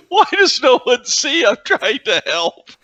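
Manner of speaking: high, slow plaintive voice broken by giggles; whining maniac villain. Acted. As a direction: protest like a misunderstood madman. Plays the misunderstood giggling villain.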